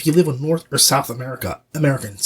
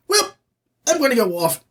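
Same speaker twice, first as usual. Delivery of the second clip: put-on narrator voice, pitched up; mock imitation of a narrator going off; 8 semitones higher than usual. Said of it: "he's just like".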